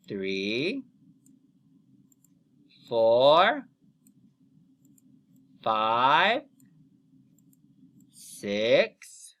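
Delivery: put-on slow count-along for kids; counting aloud. Shown as a demonstration. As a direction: count each number out for the class. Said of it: "Let's count with me".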